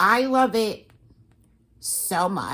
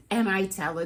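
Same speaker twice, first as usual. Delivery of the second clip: ordinary delivery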